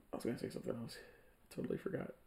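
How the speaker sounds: fading into a whisper. Real, not acted. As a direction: drop almost to a whisper. Trailing off into near whisper.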